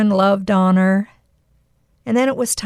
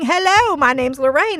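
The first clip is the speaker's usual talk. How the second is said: cheerful greeting; 6 semitones higher than usual. Retold or imitated. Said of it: "I'm saying".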